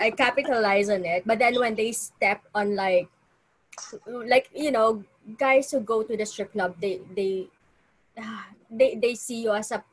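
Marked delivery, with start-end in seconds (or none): none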